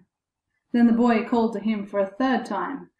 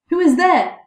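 higher, loud challenge; calling out; 8 semitones higher, 8 dB louder than usual. A narrator's reading. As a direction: call it out sharply into the dark. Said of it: "cried he".

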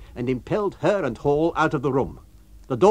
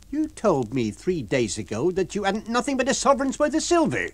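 Mrs Hall, fierce landlady; 7 semitones higher than usual. Acted. Landlady answering the lodger's complaint. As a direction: throw his question back at him.

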